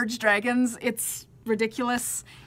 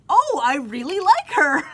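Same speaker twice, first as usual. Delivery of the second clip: pleasantly surprised; 6 semitones higher, 9 dB louder than usual. Retold or imitated. Surprised delight on finally listening.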